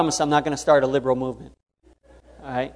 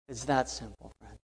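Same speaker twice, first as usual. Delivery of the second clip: much softer, intimate echo; soft repeat; 12 dB quieter than usual. Real, not acted. Preacher repeating the point to a friend, softly. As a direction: drop it down, personal.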